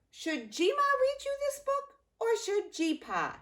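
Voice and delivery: playful question. Real, not acted. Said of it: "You let us know".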